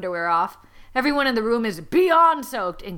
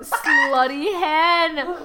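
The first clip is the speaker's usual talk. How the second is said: laughing drawn-out repeat; 5 semitones higher, 4 dB louder, much slower than usual. Real, not acted.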